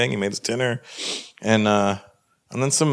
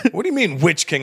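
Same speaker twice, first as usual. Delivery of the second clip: high, incredulous echo; indignant; 8 semitones higher than usual. Acted. Comedian to interrogator, indignant and incredulous. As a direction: throw it back in disbelief.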